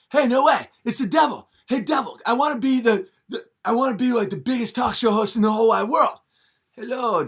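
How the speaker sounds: high, loud giddy talk-show voice; hammed-up high Fallon; giddy Jimmy Fallon. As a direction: gush like an overexcited Fallon. Fallon greets the devil.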